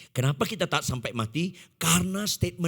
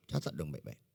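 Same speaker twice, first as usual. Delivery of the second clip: ordinary delivery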